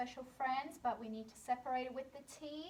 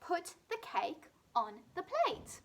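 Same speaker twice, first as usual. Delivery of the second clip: each word set out clearly, "plate" falling at the end; clear teacher model; 6 semitones higher than usual. Shown as a demonstration. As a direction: model the sentence for children to copy. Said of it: "our sentence is".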